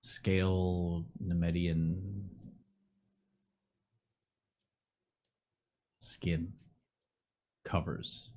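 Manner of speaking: slow, low eerie description; ominous narration. A narrator's reading. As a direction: draw it out darkly.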